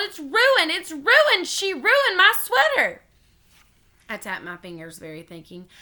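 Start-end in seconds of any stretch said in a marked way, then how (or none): 0.0-3.0 high, loud, dragging wail of outrage. A narrator's reading. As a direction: howl it like a tragedy. May wails over ruined sweater.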